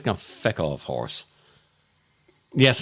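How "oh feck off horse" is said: mock dismissal. Muttered dismissal to the horse.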